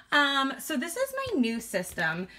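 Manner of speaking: ordinary delivery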